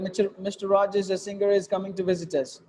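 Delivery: fast, run together without pauses; wrong way, no phrasing; rushed wrong reading. Shown as a demonstration. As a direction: rush "a singer, is coming to visit us" with no breaks. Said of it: "That's not the way you should read it".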